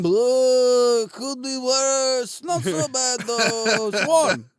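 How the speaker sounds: slow, shrugging mock-Russian; deadpan Russian contentment; hammed-up Russian accent, resigned; Russian happy voice. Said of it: "He's saying".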